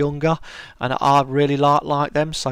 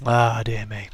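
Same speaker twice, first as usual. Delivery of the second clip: normal talking voice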